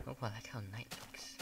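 soft unvoiced whisper to himself; murmured aside while playing. Real, not acted. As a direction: breathe it out, barely voiced.

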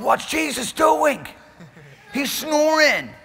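high, slow, shouted panic from the boat; panicked shout. Acted. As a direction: yell it like a drenched disciple in the storm. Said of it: "and they're going".

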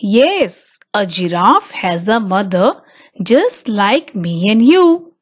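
usual speaking voice

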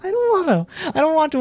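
high, near-crying whine; whimpering. Real, not acted. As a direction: whimper it out, close to tears. Terrified after chase.

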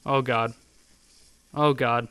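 usual speaking voice

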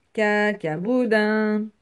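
slow, loud, stretched syllables; stubborn reply. Acted. Little rabbit refusing his sister's bath, slow loud reply. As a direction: spell it out slowly and loudly.

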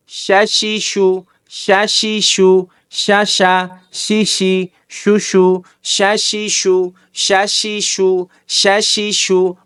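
শা শি শু said slowly, each syllable apart; slow, careful teacher drill of শা শি শু. Shown as a demonstration. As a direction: drill শা শি শু for students to copy. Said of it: "মস্ক করতে থাকবেন যতক্ষণ না হয়".

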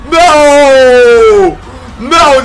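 long, loud, high no; real excited yell; hyped shout. Real, not acted. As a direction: cry out a drawn-out disbelieving shout. Excited reaction to trailer.

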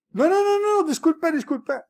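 high, loud string of frantic denials; panicked apology. Acted. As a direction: backpedal fast from a blunder. Acts out backpedalling to the officer.

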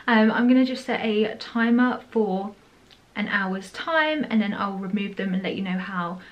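slight lisp from strips; talking with whitening strips on. Real, not acted. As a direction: teeth covered by thin strips. Seen 0: Strips pressed onto teeth just before; she keeps talking with them on.

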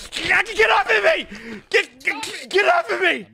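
high frantic shout; panicked yell to get off. Acted. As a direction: scream at them to let go. Grabbed, yelling to be released.

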